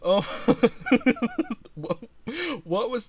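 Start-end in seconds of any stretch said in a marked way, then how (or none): 0.7-1.7 high shrieking laugh, louder; real shrieking laugh. Real, not acted. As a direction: burst out laughing. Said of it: "lightheaded from laughing".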